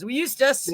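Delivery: Eustis said like "used to". Shown as a demonstration. Punning on the town name with the host. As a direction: play on "We Eustis". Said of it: "combination of used to and us".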